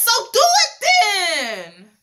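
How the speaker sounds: slow, high, loud dare; daring challenge. Retold or imitated. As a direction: throw down the challenge, dragging each word. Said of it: "And Kimbella says".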